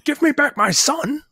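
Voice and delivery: mock high yell; retold scream. Retelling the heroine giving away her position, mock high yell. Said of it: "by screaming".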